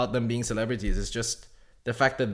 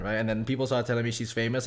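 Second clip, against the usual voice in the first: ordinary delivery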